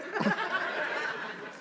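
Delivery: soft, high nervous laugh; high awkward laugh. Real, not acted. Pastor laughs softly and high at the awkward gift.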